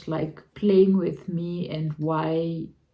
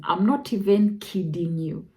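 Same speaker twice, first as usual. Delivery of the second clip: ordinary talk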